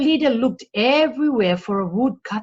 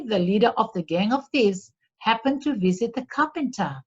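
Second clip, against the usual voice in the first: ordinary delivery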